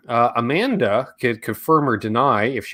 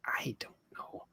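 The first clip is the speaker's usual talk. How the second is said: whispered; 3 semitones lower, 22 dB quieter than usual. Real, not acted. Muttering to himself while trying to remember.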